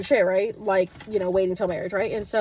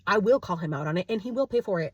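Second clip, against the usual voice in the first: ordinary delivery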